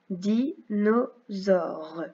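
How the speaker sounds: very slow "dinosaure" in three stretched pieces; syllable by syllable. Shown as a demonstration. Teacher reading exercise words to tick syllables, slowly syllable by syllable. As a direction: separate "di", "no", "saure" with pauses. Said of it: "on coche la syllabe".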